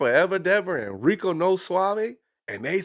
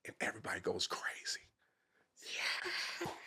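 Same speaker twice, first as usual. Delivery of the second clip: hushed aside; 7 semitones lower, 17 dB quieter than usual. Retold or imitated. Aside after crowd greeting.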